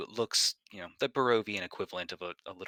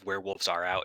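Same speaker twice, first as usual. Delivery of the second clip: normal talking voice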